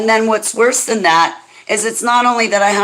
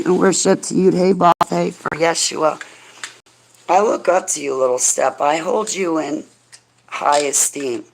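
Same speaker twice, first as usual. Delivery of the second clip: normal talking voice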